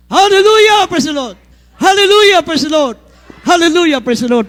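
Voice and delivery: shouting while jumping; loud, high praise shouts mid-jump. Real, not acted. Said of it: "Sabay-sabay sumigaw habang tayo tumatalon".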